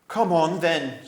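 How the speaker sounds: loud snarling challenge; a badger snarling a challenge to fight; snarled. A narrator's reading. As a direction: growl out the dare. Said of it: "snarled Truffle Hunter".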